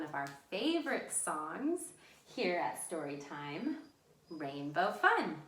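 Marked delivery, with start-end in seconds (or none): none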